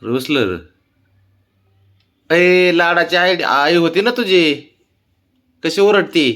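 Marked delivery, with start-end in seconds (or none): 2.3-6.3 higher, slow, singsong teasing to the pet; teasing pet talk. Real, not acted. Man teasing the pet about its mother, singsong teasing. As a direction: tease the scolded pet gently.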